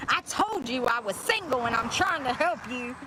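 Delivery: high, put-on voice quoting the comment; commenter impression. Streamer reading the creepy comment in mock voice. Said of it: "That's how he said it".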